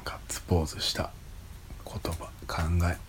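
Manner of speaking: hushed, breathy aside; whispering. Real, not acted. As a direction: whisper it under your breath. Quietly reads a topic card.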